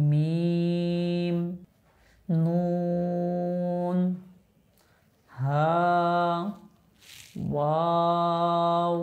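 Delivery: each letter held very long, three alif lengths; drawn-out letters. Shown as a demonstration. Teacher reciting drawn-out letters for the class. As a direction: stretch each letter out slowly. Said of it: "তিন আলিফ পরিমাণ টানতে হবে".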